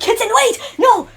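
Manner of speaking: high, loud desperate call; pleading with Kitsen not to attack; urgent plea. Acted. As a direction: shout to stop him. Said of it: "And I'm just like".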